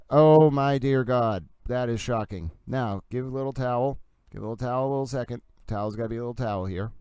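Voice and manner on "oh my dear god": slow, louder stunned "Oh, my dear God"; shocked. Real, not acted. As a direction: drag it out, stunned by the news. Reacting to shocking tweet.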